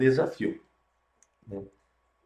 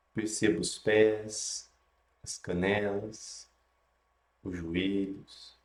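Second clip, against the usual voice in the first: guided meditation; 3 dB quieter than usual. Acted.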